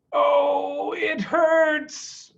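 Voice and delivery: high, crying out in pain; a wounded fox whimpering aloud. A narrator's reading.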